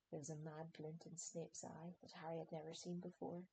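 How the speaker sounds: usual speaking voice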